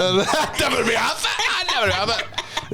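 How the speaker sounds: laughing. Retold or imitated. Repeating friend's line laughing.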